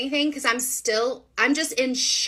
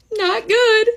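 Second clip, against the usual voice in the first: tearful break; 12 semitones higher, 6 dB louder than usual. Retold or imitated. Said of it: "then the waterworks come".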